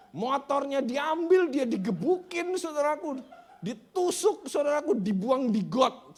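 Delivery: high, loud, drawn-out storytelling; impassioned pulpit shouting; full-force preaching. Real, not acted. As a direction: hammer out each blow of the attack.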